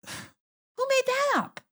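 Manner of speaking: very high, slow mock question; mock slow incredulous voice. Guest mocking the idea in a high, slow voice. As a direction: drag it out high like a cartoon skeptic.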